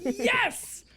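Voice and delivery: high-pitched, emphatic exclamation; jubilant bartender. Acted. Bartender agreeing to serve drinks, jubilant. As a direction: burst out with delight. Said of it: "He says".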